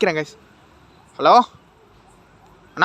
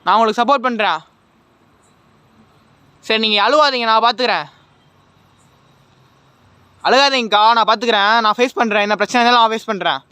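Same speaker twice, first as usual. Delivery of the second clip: raised, earnest comfort to a weeping spirit; loud reassurance; 3 semitones higher, 6 dB louder than usual. Real, not acted. Ghost hunter reassures the crying sister he'll help, loud. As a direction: promise her help, loudly.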